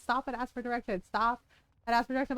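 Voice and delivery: ordinary delivery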